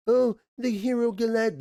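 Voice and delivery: high, hammed-up storybook announcer; sing-song mock herald; mock puppet-play narrator. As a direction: parody the cheesy puppet show. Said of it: "like, a puppet play".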